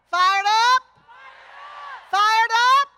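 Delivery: loud rising call to the crowd; rally call. Real, not acted. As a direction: fire up the crowd. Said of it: "call and response".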